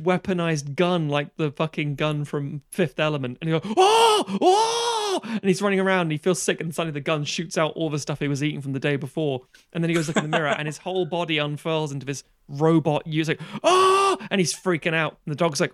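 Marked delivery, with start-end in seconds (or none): 3.8-5.2 high, loud mock scream of horror; hammed-up shriek, held long; panicked scream. Acting man's horror as hand transforms. 13.6-14.2 high, loud mock shriek; hammed-up scream of shock, held long; horrified scream. Acting man seeing his robot body.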